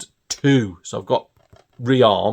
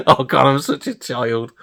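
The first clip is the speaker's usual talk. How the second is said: giggly and a bit slowed; sheepish chuckling admission; laughing at himself; 3 semitones higher, 4 dB louder than usual. Real, not acted. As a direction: still laughing through the words. Laughing at his own rude joke.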